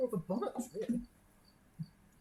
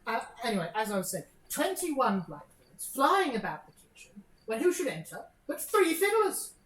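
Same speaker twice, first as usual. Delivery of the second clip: chattering story, higher and louder; a lady breezily changing the subject; rattling gossip; 6 semitones higher, 7 dB louder than usual. Acted. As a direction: prattle on brightly, slowed for effect.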